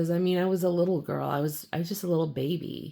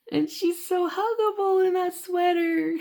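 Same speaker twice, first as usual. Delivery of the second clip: put-on high, sweet toddler gushing; childlike gush; 13 semitones higher than usual. Gushing about the bus driver's sweater, high toddler coo. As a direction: coo it like a smitten little kid.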